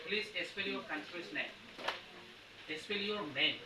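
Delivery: slow, soft request in English; quoting a gentleman. Retold or imitated. Teacher imitating a gentleman asking to spell a name, slow and soft. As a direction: lightly voice a polite foreigner asking. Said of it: "কোনো একজন ভদ্রলোক কোনোদিন বলে যে".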